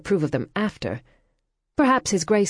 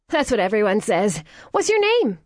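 much higher, louder, bubbly question; cheerful American girl; 5 semitones higher, 4 dB louder than usual. Acted. American girl to Cordelia, bubbly and high. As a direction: brush off the insult and beam.

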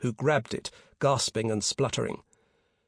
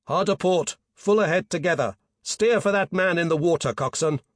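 raised, higher helm order; urgent command; 5 semitones higher, 5 dB louder than usual. A narrator's reading. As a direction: call out orders to the crew, loud and firm. Skipper calling urgent helm orders.

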